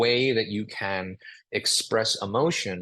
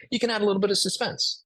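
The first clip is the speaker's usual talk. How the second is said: a touch of suspense; 10 semitones higher, faster than usual. Shown as a demonstration. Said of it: "investigate another reason".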